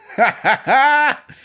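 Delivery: high, loud laugh bursting out; burst of laughter. Real, not acted. Laughing at grandfather memory.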